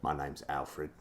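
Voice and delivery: low, soft British butler voice; Michael Caine. Acted. Both trying the actor as butler, low British voice. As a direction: imitate the old actor, dropped down low. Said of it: "That's how Michael Caine talks".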